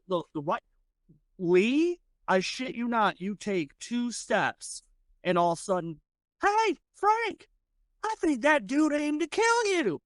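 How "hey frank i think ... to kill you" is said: high, jeering saloon thug; mocking thug. Thug jeering at the stranger, high mocking voice. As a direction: taunt the player like a rowdy cowboy.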